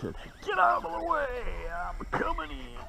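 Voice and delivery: high, eager coaxing; coaxing the fish. Real, not acted. Talking to fish while reeling.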